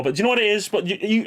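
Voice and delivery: ordinary delivery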